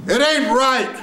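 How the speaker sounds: hammed-up, very high cry; exaggerated imitation. Shown as a demonstration. Coach showing what the student did, exaggerated high cry. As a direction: overdo the line high and emphatic. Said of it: "I'm exaggerating".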